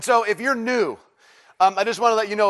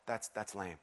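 barely voiced, much softer and lower, no conviction; limp, half-hearted that's lame; 11 semitones lower, 20 dB quieter than usual. Shown as a demonstration. Pastor demos the weak way, lifeless mutter. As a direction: mumble it like you don't care. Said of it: "meaning not like".

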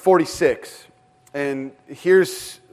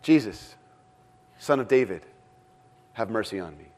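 quiet plea; 8 semitones lower, 8 dB quieter than usual. Retold or imitated. Blind beggar's plea voiced.

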